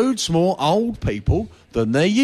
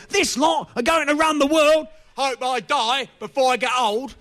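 put-on grumpy old-timer; 7 semitones higher than usual. Comic voicing his own grumpy thought about young folks. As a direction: scoff like a fed-up old codger.

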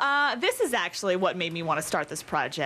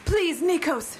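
higher-pitched, pleading heroine; begging; 8 semitones higher, slower than usual. A narrator's reading. Reader voicing romance heroine to her lover, begging. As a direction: plead with him in a raised pitch. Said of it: "she begged".

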